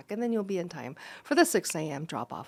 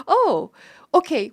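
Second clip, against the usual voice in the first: surprised; 6 semitones higher, 7 dB louder, slower than usual. Retold or imitated. Elon surprised by buyer.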